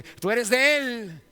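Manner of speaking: high, loud and drawn-out slow, in a changed voice; the servant girl repeats her charge slowly; slow accusing servant girl. Retold or imitated. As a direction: stretch the accusation out, savouring it. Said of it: "era una mujer, una muchacha".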